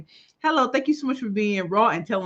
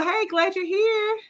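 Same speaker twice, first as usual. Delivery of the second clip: high welcome; 12 semitones higher, slower than usual. Real, not acted. Welcoming a viewer.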